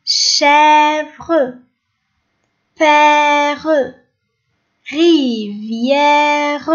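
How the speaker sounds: slow, firm word-by-word reading; model reading. Shown as a demonstration. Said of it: "Lisez avec moi".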